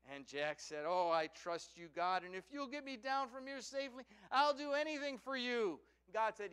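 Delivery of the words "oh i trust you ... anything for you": eager pleading. Acted. Dangling Jack pleads with God.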